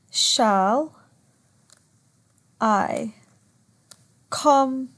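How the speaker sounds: "shall I compare" one word at a time, long pauses between; measured, isolated beats on "shall I compare"; demonstrating meter. Shown as a demonstration. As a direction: scan "shall I compare" slowly, leaning on COM. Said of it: "That's how you would do it generally".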